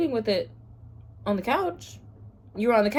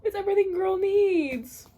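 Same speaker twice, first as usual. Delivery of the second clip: squealing. Real, not acted. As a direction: squeal with delight. Squealing crying laugh.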